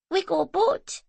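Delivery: put-on high child's voice, pleased; chirpy little boy; small boy. A narrator's reading. Little boy boasting to Zoe about the boat.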